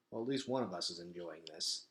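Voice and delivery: wry inner thought. Acted. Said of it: "thought Gregor".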